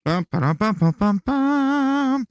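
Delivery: vocal drum roll. Pretend. Streamer mimics a drum roll for viewers, loud vocal beat. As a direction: hum out a drum roll with the mouth. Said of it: "Drum roll, please".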